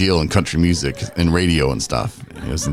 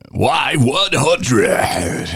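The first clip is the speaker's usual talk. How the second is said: very slow, stretched, higher DJ call; radio jock call letters; 7 semitones higher, much slower than usual. Retold or imitated. DJ imitating his old on-air call, stretched high. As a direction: drag out Y one hundred like a jock. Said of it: "I would just puke it up".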